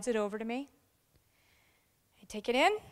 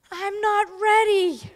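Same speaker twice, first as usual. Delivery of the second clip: higher, louder, slow "I'm not ready"; doubting colleague; 9 semitones higher, 9 dB louder, slower than usual. Retold or imitated. New officer refusing praise, imitated higher and louder. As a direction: imitate her nervous refusal. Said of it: "what she says to me".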